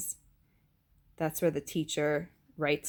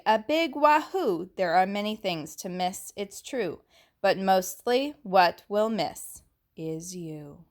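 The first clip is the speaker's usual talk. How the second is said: sing-song rhyming read-aloud; lilting verse read to children; poem reading; 5 dB louder than usual. A narrator's reading. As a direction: read the poem with feeling for the kids. Reads the class poem.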